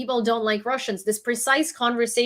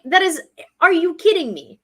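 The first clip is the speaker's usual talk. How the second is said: louder, higher, incredulous; exasperated outburst; 6 semitones higher, 5 dB louder than usual. Retold or imitated. Telling off a Russian commenter, loud and incredulous. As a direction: hit him with disbelief, raised voice.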